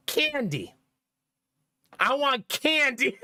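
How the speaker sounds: drawn-out mock toddler demand. Toddler demanding candy at the store, slow mock insistence. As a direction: imitate a small child insisting on a treat. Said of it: "you know what he said".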